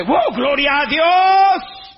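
loud, high, drawn-out cry of praise; joyful praise. Acted. Preacher rejoicing before the congregation, loud and slow. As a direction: shout Gloria a Dios slowly, full of joy.

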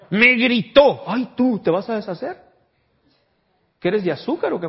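high, loud scoffing teasing; mocking scorn. Preacher scolds touchy Christians with loud high scorn. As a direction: scoff at someone too sensitive. Mocking a thin-skinned believer.

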